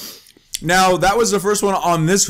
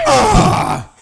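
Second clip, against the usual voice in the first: pained yelp; 5 dB louder than usual. Real, not acted. Cry after losing in game.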